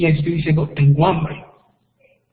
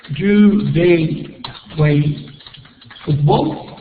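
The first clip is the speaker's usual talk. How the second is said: slow question demo; much slower than usual. Shown as a demonstration. Teacher reading the example question aloud to the class, very slow. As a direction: Do they play football, stretched very slowly with a rise. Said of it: "teacher modelling English question".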